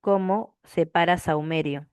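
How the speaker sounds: slow, spaced-out "¿Cómo separa saumerio?"; slow repeated question. Real, not acted. Teacher waiting for students to answer, slow and deliberate. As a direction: draw out "Cómo", pause, then the rest.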